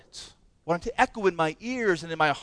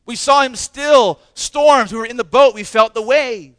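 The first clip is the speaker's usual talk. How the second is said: louder, pounding each short clause; preaching at full force; 9 dB louder than usual. Acted. Preacher stirring the congregation with eyewitness claims, loud. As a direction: hammer home every line like a drumbeat.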